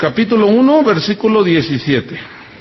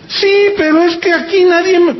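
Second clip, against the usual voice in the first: whiny self-pity; 10 semitones higher than usual. Retold or imitated. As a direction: whine like someone who feels unloved. Imitating the lady's reply.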